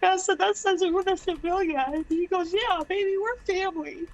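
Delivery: fighting back real tears while telling it; choked up crying. Real, not acted. Telling friends about coworker's kindness, tearfully breaking. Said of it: "Kinda ugly crying".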